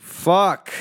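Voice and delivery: sudden loud, high curse; angry shout. Acted. Host frustrated mid-story, shouting a curse. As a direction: blow up on the word.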